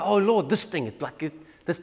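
mock whining to the Lord, fading into a mumble; a petulant believer griping, trailing off; whiny complaint. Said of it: "We're so quick to complain".

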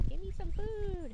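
high, slow pleading bird voice; voicing the chicken. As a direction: beg for food like a hungry little bird. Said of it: "he's like".

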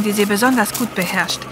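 ordinary delivery, nothing marked

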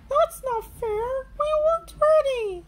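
high, loud whiny wail; moaning Peppa Pig. A narrator's reading. Peppa moans that the goal was unfair, high whiny wail. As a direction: complain tearfully. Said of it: "moans Peppa".

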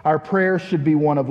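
usual speaking voice

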